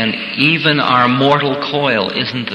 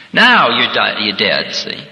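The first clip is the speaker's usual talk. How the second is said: ordinary delivery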